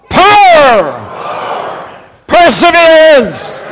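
loud, drawn-out Irish chant of the words; rousing mock Irish. Shown as a demonstration. Preacher getting the Irish to lose inhibitions, rousing mock accent. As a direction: lead the crowd, stretching each word in Irish accent. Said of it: "This is the Irish getting".

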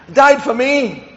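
ordinary delivery

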